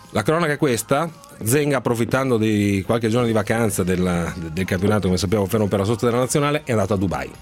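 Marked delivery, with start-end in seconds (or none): none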